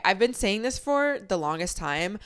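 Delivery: ordinary delivery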